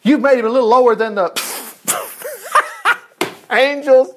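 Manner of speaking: reading the verse but cracking up; laughing through the verse. Real, not acted. Preacher to congregation, laughing through the verse. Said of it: "Oh, please, I've heard it all".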